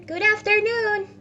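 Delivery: high, loud shout; exasperated dismissal. Acted. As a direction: shout him out of the office. Scrooge's final exasperated dismissal.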